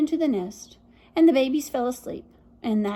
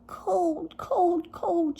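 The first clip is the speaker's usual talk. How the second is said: shivering babies; 4 semitones higher, much slower than usual. Acted. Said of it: "shivered the babies".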